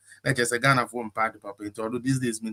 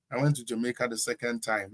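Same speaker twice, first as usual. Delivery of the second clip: ordinary talk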